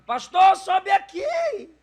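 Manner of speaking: high, desperate shout from above; woman screaming from a building. Retold or imitated. Woman yells from a building at the passing pastor, high desperate shout. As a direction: call out to the pastor at the top of her lungs. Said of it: "uma mulher de um prédio gritava".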